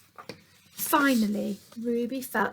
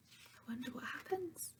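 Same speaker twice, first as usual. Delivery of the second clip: soft unvoiced aside; hushed storytime whisper; 4 semitones higher, 19 dB quieter than usual. Real, not acted. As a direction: whisper it to build suspense. Teacher aside to children, whispered.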